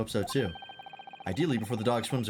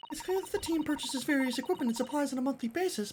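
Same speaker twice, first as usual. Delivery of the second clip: put-on much higher, polite young assistant; high girlish assistant voice. Assistant hoping the scent leads to the swindler, high and polite. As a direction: lift into a prim young lady's voice.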